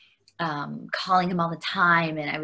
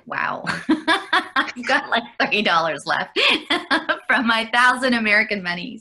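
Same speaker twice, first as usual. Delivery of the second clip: laughing disbelief; 5 semitones higher, 5 dB louder than usual. Retold or imitated. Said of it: "And I was like".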